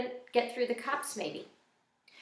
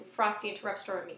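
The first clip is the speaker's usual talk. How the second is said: plain reading voice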